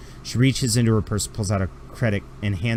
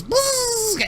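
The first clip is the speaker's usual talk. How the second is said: very high, sustained mock buzz; drill buzz. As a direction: buzz like a tiny power drill. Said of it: "a mechanism in it that goes".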